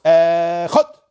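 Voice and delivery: Echad with the first vowel stretched out; long aleph in Echad. Shown as a demonstration. Teacher showing a wrong reading, long E in Echad. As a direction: drag the E, then snap chad in Echad. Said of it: "if you say a long aleph".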